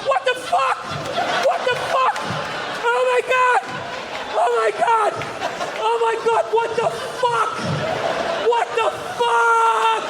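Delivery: high frantic screams of no; panicked screaming. Acted. Young pilot screaming as passenger jumps out, high panic. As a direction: beg the passenger to stop, panicking.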